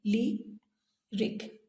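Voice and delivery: ली and रिक said apart, softly, with a pause between; split into syllables. Shown as a demonstration. Teacher breaking lyric into two syllables, ली, pause, रिक. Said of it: "लिरिक के दो syllable हैं".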